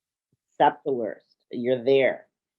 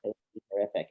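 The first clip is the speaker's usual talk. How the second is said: quiet echo; 6 semitones lower, 9 dB quieter, much faster than usual. Shown as a demonstration. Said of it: "coach repeats client's words".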